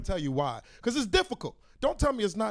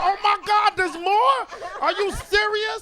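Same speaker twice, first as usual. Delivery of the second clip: high exasperated disbelief; high mock-incredulous whine; 10 semitones higher, 3 dB louder, slower than usual. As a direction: act shocked it's still going. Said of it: "I said".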